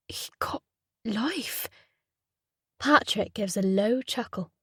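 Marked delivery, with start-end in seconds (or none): 0.1-1.7 unvoiced, soft shocked whisper; choked whisper. Patrick stunned by the news, choked whisper. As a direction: barely get it out.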